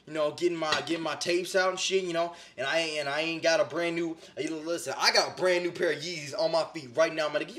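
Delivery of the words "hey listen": normal talking voice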